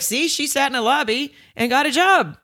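high-pitched mock mom voice; imitating mom. Mom pointing to the movie as proof, high imitated voice. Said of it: "if my mom watched".

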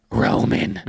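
breathy, unvoiced whisper of a name; whispered mock menace; creepy whisper. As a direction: imitate a creepy breathing voice memo. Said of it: "breathing into the mic".

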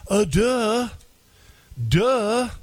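mocking, obvious-isn't-it tone; sarcastic duh. Real, not acted. Mocking the quoted claim.

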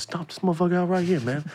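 a hint of Charlie Murphy's warning; Charlie Murphy impression. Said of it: "like Charlie Murphy".

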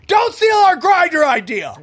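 high, slow mock-outraged shout. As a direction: yell it in playful outrage. Joking protest at the co-host.

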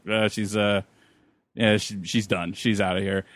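low, softened offhand doctor voice; retold callous brush-off, low and quiet; imitating dismissive doctor. Voicing the callous doctor's answer.

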